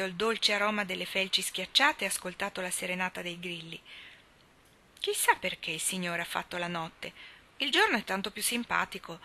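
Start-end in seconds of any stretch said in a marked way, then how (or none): none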